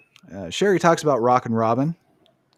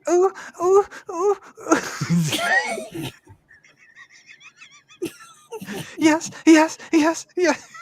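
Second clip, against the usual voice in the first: porno moans; much slower than usual. Pretend. Mock porn moaning on podcast.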